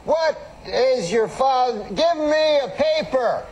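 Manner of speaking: high, slow Cosby voice rising further; Cosby demanding a paper, slow and high; Bill Cosby impression. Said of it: "He has that funny way of talking".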